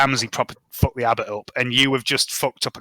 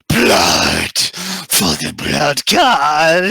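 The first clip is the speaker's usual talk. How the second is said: monster's bellow; 3 semitones higher, 7 dB louder, much slower than usual. Acted. Monster roars over its torn-apart victim, full bellowed war cry. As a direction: bellow it loud like a raging beast, stretching the last word. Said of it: "And he bellows".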